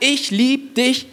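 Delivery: slow and high, tender and drawn out; tenderly, like a loving father; God's tender love. Acted. God to every listener, slow and tender. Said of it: "Gott sagt".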